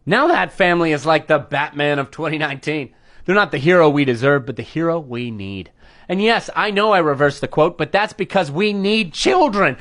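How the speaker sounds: ordinary delivery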